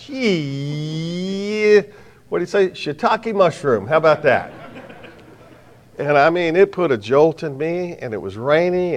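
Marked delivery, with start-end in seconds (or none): 0.0-4.5 sarcastic aside. Retold or imitated. Mock reply to the Marine.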